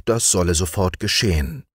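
ordinary delivery, nothing marked

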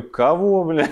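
baffled question through a laugh; laughing disbelief. Retold or imitated. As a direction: laugh it out, puzzled. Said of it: "я говорю".

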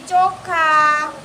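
put-on ચોખા stretched very slowly for the child; slow model word. Shown as a demonstration. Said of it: "ઘઉં નથી". (ધીમે ધીમે ચોખા બોલીને શીખવવું)

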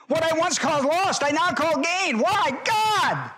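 high, ringing preacher's holler. Real, not acted. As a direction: holler it out to the whole church. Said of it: "I don't know why I'm hollering".

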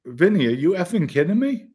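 high-pitched indignant disbelief; retold heated protest; upset, indignant. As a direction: throw it back at him, incredulous. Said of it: "it got me upset".

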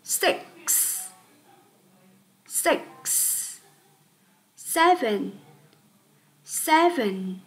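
each number six to ten said twice, soft and clear; counting model. Shown as a demonstration. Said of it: "đó là". (đếm mẫu từ six đến ten, mỗi số hai lần)

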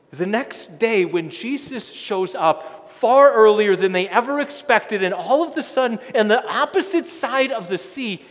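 loud, building sermon delivery; full-force preaching. Acted. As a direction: preach it out to the whole congregation.

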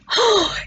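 a quick high gasp; sharp gasp of recognition. Acted. Bertie gasps, spotting the lion poster. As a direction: catch your breath at the sight.

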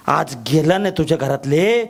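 usual speaking voice

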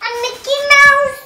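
high excited squeal; thrilled, voice jumping up. Real, not acted. Excited discovery of gift.

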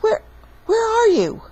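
very high, loud searching call. Acted. Papa searching in the dark, high-pitched calling. Father calls back, searching.

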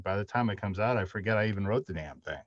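ordinary delivery

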